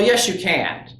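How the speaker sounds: ordinary delivery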